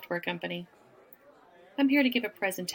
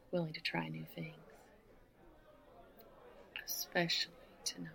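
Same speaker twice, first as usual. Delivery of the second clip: teasing whisper; 2 semitones lower, 12 dB quieter, faster than usual. Acted. Whispering to a young man at the bar, teasing. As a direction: drop to a soft, breathy-free whisper on the last words.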